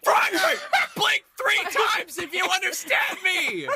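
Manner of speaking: very high frantic scream. Acted. Player testing whether the frog understands, screaming. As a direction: shriek it as a desperate demand. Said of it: "I start screaming in frog".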